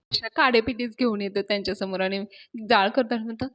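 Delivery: ordinary delivery, nothing marked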